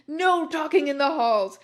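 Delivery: loud, high hammed-up scolding; angry shout. A narrator's reading. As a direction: bellow it like an outraged headmaster, mock-serious. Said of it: "started shredding at us angrily".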